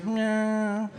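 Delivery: high mock cat meow. Neighbor meows again.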